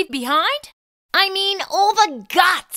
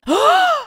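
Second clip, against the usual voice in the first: sharp horrified gasp; 10 semitones higher than usual. Acted. Sees gum on page.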